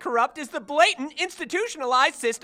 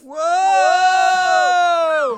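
loud startled whoa; shout; 5 semitones higher, 10 dB louder than usual. Acted. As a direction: yell it out. Shouted exclamation.

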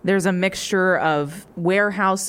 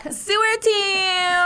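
high, drawn-out group cheer; taunting high-pitched tween chant; mean tweens; 13 semitones higher, much slower than usual. Acted. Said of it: "this group of, like, mean-looking tweens".

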